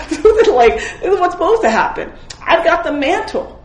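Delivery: laughing and thrilled at the story; excited. Real, not acted. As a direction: tell it bursting with glee. Excited retelling of Elisha miracle.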